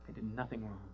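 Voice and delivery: near-silent tearful repeat; soft echo. Retold or imitated. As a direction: let it fall away almost to nothing. Echoing her protest softly.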